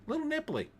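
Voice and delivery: high, cheeky put-on voice on "nipply"; silly high voice. Host cracks a nipply pun in a silly high voice. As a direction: squeak the pun out coyly.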